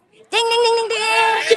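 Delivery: high sung bell dings; ding ding bell sound. Pretend. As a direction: chime like a quiz bell. Quiz host signals correct answer.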